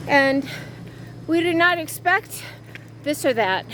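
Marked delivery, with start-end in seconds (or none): none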